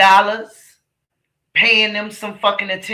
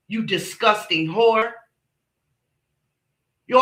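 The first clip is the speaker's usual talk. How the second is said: normal talking voice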